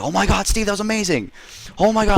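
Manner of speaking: high-pitched ecstatic cheering from the crowd; excited crowd cheer. Acted. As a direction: gush like a stunned crowd. Crowd cheering Steve's dive.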